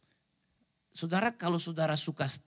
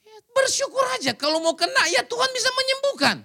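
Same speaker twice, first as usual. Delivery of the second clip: much higher, louder call to give thanks; excited high proclamation; 6 dB louder than usual. Real, not acted. Preacher urging the scared congregation to simply be thankful, excited and high. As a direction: lift the whole room with joy.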